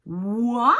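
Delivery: «what» loud, with a sweeping rise; caricatured English intonation. Shown as a demonstration. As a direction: swing «what» upward emphatically. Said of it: "je caricature un peu".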